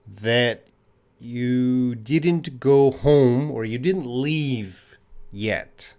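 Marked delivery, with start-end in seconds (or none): none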